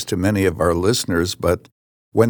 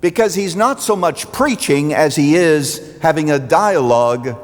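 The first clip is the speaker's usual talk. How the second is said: steady sermon voice, building in places; preaching; 4 semitones higher than usual. Acted. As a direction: preach it to the congregation.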